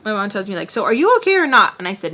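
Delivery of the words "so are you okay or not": louder, higher exasperated question; impatient demand. Acted. Mom losing patience with daughter, impatient demand. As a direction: demand an answer, raised voice.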